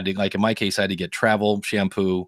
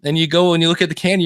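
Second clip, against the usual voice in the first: ordinary talk, nothing marked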